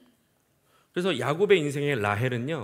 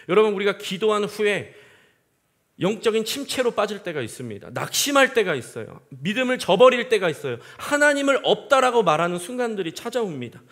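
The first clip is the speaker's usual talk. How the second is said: higher, louder impassioned preaching; preaching at full force; 6 semitones higher, 7 dB louder than usual. Acted. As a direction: drive the point home to the congregation. Preacher building intensity. (높고 크게 열정적으로 설교)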